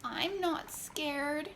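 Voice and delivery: drawn-out scared whimper. A narrator's reading. Wet Jeremiah drying off in the sun, slow whimper. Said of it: "whimpered Jeremiah".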